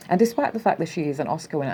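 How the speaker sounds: fast, hurried rereading of the quote; fast skim through the words; rushed reread. Shown as a demonstration. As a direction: rattle the line off quickly to get past it.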